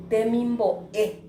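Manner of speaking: Temimbo, e, loud with the final E set apart and stressed. Shown as a demonstration. Teacher shows the tonic vowel, final e of Temimbo, e stressed. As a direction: land firmly on the closing e of Temimbo, e. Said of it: "vocal tónica".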